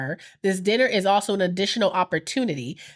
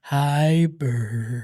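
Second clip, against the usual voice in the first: much lower, put-on deep voice; 7 semitones lower than usual. Shown as a demonstration. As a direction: drop the voice way down. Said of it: "I can get deep".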